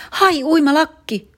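high, slow, shrill-pitched shriek of panic; child's panicked high shriek. Acted. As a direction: shriek it out like a frantic kid. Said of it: "Tarmo kiljui".

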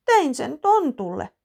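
put-on high, loud tearful child voice; girl explains through tears, high tearful child voice; sobbing. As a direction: sob it out. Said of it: "Iina nyyhkyttää".

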